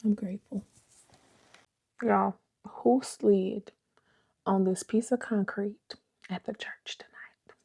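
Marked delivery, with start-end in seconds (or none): 2.0-7.6 slow whisper. Real, not acted.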